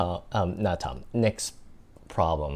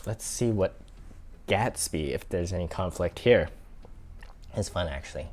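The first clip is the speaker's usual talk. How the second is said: ordinary delivery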